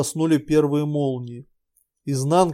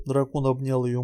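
ordinary delivery, nothing marked

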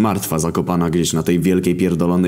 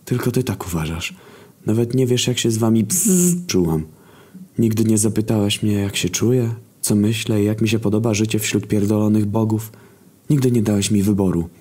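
lightly higher complaining voice; a hint of Agata; 4 semitones higher than usual. Agata complaining to War over the phone. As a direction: complain down the line.

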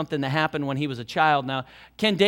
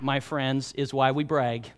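ordinary delivery

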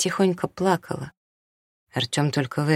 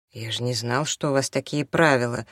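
ordinary delivery, nothing marked

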